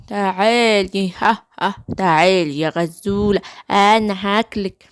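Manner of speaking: low, gloating wolf voice; low predator closing in on prey; menacing wolf. Acted. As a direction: threaten low, with a villain's laugh. Wolf seizes and threatens gazelle.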